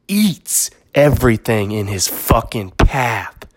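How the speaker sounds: lightly imitating a Christopher Walken movie speech; dramatic retold movie story. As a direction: spin the lion tale like a brooding monologue. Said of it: "This is what he says".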